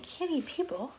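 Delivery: usual speaking voice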